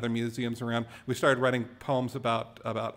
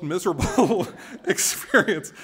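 laughing through the words; 5 semitones higher, 5 dB louder than usual. Real, not acted. Speaker recalls a grim outing with dad, laughing. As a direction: let a laugh break up the phrase.

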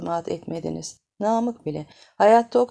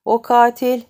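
furious shout; 5 semitones higher, 11 dB louder than usual. Acted. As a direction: yell it in rage. Grieving man demands killer.

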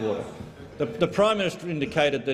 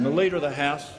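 ordinary delivery

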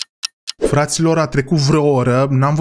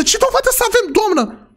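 indignant disbelief. Acted. Arguing with the headmistress.

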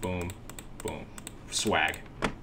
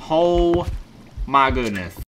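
usual speaking voice

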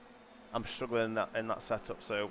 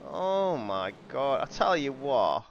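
ordinary talk

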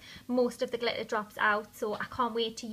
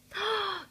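surprised gasp; 10 semitones higher than usual. Real, not acted. Smells the gloss.

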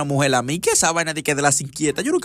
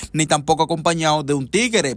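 normal talking voice

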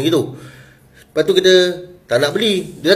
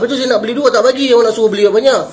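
high, fast exasperated protest; retold irritated comeback, pitched way up; exasperated retort; 9 semitones higher, 4 dB louder, faster than usual. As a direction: argue back in a raised, fed-up voice. Retelling his annoyed argument with boy.

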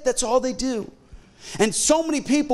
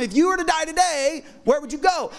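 higher, pushy canned question; teen doorknocker pitch; 7 semitones higher than usual. Retold or imitated. Teenage evangelist quizzes a sleepy stranger at the door, high and eager. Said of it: "telling them".

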